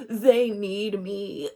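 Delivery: slow, smug hint of a needy diva; self-important needy. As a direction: lightly play someone who thinks they're needed. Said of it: "I couldn't even pretend to, like, be that character".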